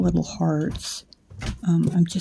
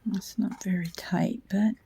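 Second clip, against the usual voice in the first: whisper. Real, not acted. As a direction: murmur to yourself, careful not to wake anyone. Late night, whispering while working wire.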